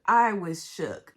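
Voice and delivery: I was shook said slowly and loud; loud, slow emphatic repeat; slow stretched repeat. Real, not acted. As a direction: drag each word out for emphasis.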